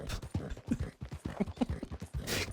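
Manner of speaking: airy breathless giggle; hushed wheeze of a laugh; wheezy silent laugh. Real, not acted. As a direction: laugh without voice. Laughing at her own joke.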